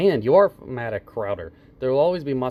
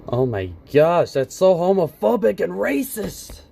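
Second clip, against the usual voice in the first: slowed, louder, hammed-up fake shock; mock outrage; 4 semitones higher, 4 dB louder than usual. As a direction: act scandalized over nothing. Mocking Carlos's outrage.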